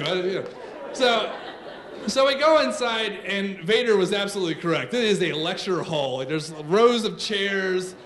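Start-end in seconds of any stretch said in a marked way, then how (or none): none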